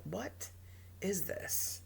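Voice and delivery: hushed, slow disbelief; softly baffled, with a pause mid-phrase; retold quiet disbelief. Retells own disbelief at scene.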